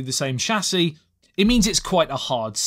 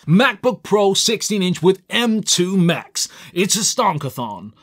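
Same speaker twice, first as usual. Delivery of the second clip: slow, hammed-up American accent; bad American accent; 3 semitones higher than usual. British reviewer mock-advertising the MacBook Pro, bad American accent. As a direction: put on a terrible drawn-out American accent. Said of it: "probably the worst American accent".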